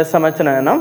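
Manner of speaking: normal talking voice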